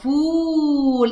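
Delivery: فول with the long u held very slowly; teacher draws out the و in فول; stretched demo. Shown as a demonstration. Teacher demonstrates madd with waw, stretched vowel. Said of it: "طولت في صوت إيه".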